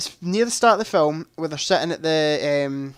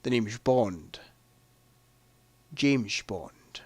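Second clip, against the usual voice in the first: low, quiet screen-spy introduction; Bond in Sean Connery's manner; 6 semitones lower, 6 dB quieter, much slower than usual. Acted. Re-enacting Bond's reply at the card table, low and understated. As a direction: lower and soften it, with shushed s sounds and a long pause. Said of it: "And he goes".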